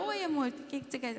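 soft, sweet "oi, amor"; sweet reply. Retold or imitated. Wife sweetly answering her injured husband the first time. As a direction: answer him tenderly.

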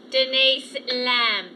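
very slow, hypnotised name; dazed monotone. Acted. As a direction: say your name as if in a trance. Dazed woman repeats her name.

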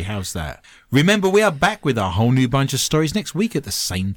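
show host voice. Acted. Show host sign-off read.

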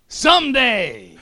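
high, slow, laughing tease; comic punchline. Retold or imitated. The same skydiver finishes his joke to the nervous jumpers, high drawn-out tease. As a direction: stretch it out to land the joke. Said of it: "he goes".

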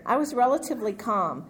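normal talking voice

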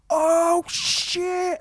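mock hype. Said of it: "He's like".

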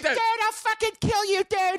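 very high strained mock fury; hammed-up high-pitched rage; imitated yelling. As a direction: squawk threats like an enraged friend. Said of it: "while he's yelling, going".

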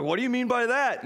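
high, put-on Southern accent asking back. Southern friend questioning the preacher on the phone, in a Southern accent. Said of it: "he's got a deep Southern accent".

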